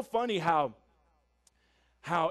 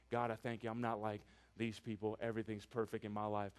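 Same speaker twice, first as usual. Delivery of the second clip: smug Pharisee prayer; 11 semitones lower, 11 dB quieter than usual. Retold or imitated. The Pharisee praying before God, quietly smug. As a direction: lower and much softer, lightly smug. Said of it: "he's praying".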